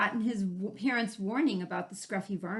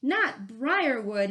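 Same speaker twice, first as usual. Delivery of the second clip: louder, slow pained yelp; 4 semitones higher, 6 dB louder, much slower than usual. Acted. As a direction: yelp it, drawn out. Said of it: "he yelped".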